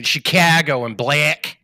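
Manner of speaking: slow, exaggerated Chicago vowels; mock Midwestern Chicago accent. Shown as a demonstration. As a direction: stretch the flat Chicago A. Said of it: "we're talking, like, Chicago".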